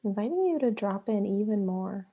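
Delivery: unhurried meditation guide with long pauses between phrases; guided meditation. Acted. Yoga therapist guiding listeners into breath and flow, guided meditation. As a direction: lead them into the breath, leaving long silences.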